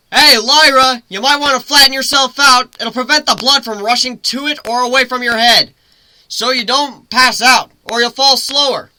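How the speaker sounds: loud, high call across the wind; griffin calling out. Acted. Griffin shouting to Lyra as she falls, high and loud. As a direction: shout advice down to her mid-fall.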